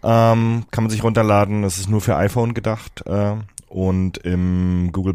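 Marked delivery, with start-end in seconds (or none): none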